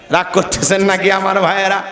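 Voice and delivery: ordinary talk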